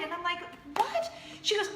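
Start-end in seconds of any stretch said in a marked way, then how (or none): 0.8-1.1 very high, quick astonished yelp; stunned disbelief. Retold or imitated. Host answers friend's surprise news, stunned disbelief. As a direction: squeak it out in shock. Host's retold stunned reaction.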